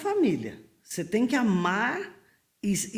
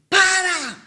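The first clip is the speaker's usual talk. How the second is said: loud high yell, Para; shout to stop; 11 semitones higher, 9 dB louder than usual. Retold or imitated. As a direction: bark the command. Someone yells to stop.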